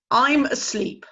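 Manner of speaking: loud, slow owl's grumble; grumpy father owl. Acted. Father owl grumbling at his chick. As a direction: send the chick away.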